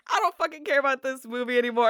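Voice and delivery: high, rushed exasperation; retold pissed off. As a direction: rattle it off, fed up. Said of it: "to just pissed".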